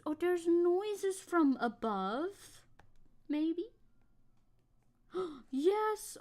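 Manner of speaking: high, slow cooing question. Real, not acted. Streamer slowly coos to her dog about noises upstairs, high baby talk. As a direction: gently ask the pup what scared him.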